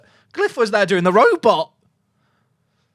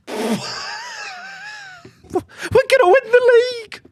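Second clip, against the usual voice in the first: hammed-up boast. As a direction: crow it like a deluded fan. Mock overexcited boast.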